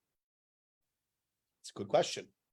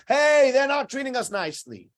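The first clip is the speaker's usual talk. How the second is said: whiny complaint; 7 semitones higher, 4 dB louder than usual. Retold or imitated. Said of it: "run to the police and say".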